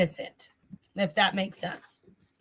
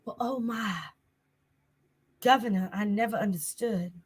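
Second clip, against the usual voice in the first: Southern belle; 5 dB quieter than usual. Pretend. Tarot reader hamming up her southern things, Southern belle accent. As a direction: play a mock-genteel Southern belle. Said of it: "My Southern Belle moments".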